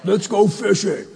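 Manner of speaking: dopey Peter voice. Pretend. Preacher imagining Peter as a big dumb fisherman. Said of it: "I wonder what he had sounded like".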